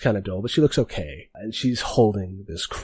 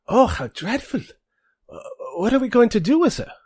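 much higher, louder, faster mock-dismayed voice; prim woman's voice; 6 dB louder than usual. Third bandit fusses over what to do with the prisoner, high and dramatic. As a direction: gasp over the captive with exaggerated dismay. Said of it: "and she says".

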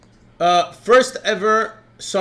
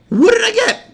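much higher, louder desperate question; mock panic, high-pitched; panicked question; 7 dB louder than usual. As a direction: squeak it out in panic.

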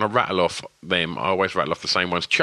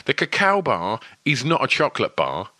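upbeat, higher sponsor announcement; excited ad read; 4 semitones higher than usual. Acted. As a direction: sell it like big news. Host reads sponsor ad.

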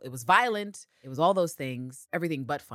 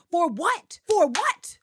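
loud, high, rising exasperated demand; exasperated shout; 9 dB louder, much slower than usual. Real, not acted. Ranting at the show's writers, exasperated shout. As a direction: yell it at the writers in disbelief.

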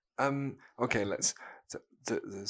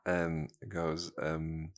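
normal talking voice

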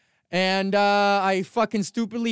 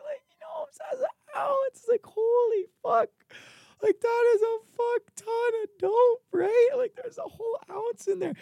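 much higher, stretched disbelieving shriek; shocked squeal. Retold or imitated. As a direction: play a stunned teenager squealing at a huge stash. Retold high-school amazement over ounce.

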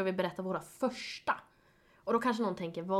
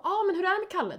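high mock question; 10 semitones higher, 4 dB louder, faster than usual. Herself voiced in retold chat.

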